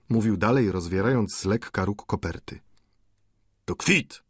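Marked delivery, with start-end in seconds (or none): 3.6-4.2 sudden high exclamation; exclaimed discovery. A narrator's reading. Lodger peeking into a letter, high exclamation. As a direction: pipe it up as a discovery. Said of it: "zawołał".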